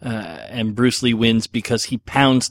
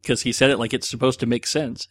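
normal talking voice

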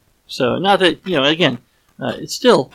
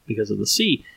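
ordinary talk, nothing marked

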